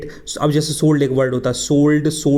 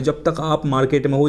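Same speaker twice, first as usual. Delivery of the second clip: ordinary talk, nothing marked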